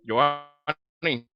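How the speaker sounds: glitchy robotic bad-connection voice; half robot voice. Real, not acted. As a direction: sound like a choppy robotic call line. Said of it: "le estábamos escuchando con voz robótica".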